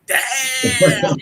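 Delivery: loud, awed Damn; impressed exclamation. Retold or imitated. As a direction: let it burst out at the sight. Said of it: "be like".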